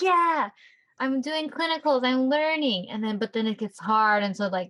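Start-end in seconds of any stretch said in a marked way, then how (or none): 0.0-2.9 playful imitation of an eager student; mock excitement. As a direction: brag happily about starting clinicals. Mimics upbeat student starting clinicals.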